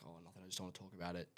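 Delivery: soft, very fast deflection; acted evasive reply, tossed off under the voice; dismissive brush-off. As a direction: rush it out quietly to close the subject. Acting out brushing off a parent.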